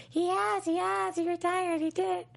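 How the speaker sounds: high little girl impression; squeaky girly mock voice. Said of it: "I sound like a, a little girl".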